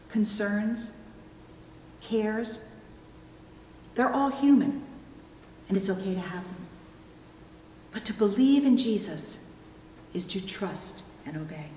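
normal talking voice

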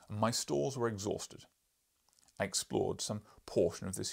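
loud, booming lecturing professor; gruff professor. Acted. Professor lecturing the journalist, loud and booming. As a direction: boom like an irritable scholar.

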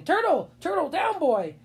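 high, urgent call to a dog; calling after a runaway dog. A narrator's reading.

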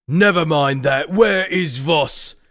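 loud, slow, demanding captain; stern commander Morgrig. Acted. Morgrig demands from Dirk where Voss is, slow stern captain. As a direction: brush off the relief and demand an answer.

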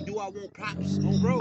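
deep mocking voice echoing the question; mocking imitation. Mimicking the guy who asked about props, in a deep mocking voice. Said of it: "Don't tell me".

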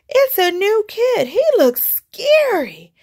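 high, loud frightened cry; scared cry. Acted. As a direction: cry out in alarm at the shadow. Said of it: "cried Joey Mackerel".

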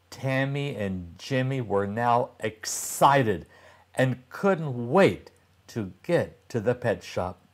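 ordinary delivery

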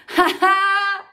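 loud, mocking cackle; cackling laugh. A narrator's reading. Henry cackles at Peter after fooling him. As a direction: cackle in triumph at the trick. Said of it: "crackled horrid Henry".

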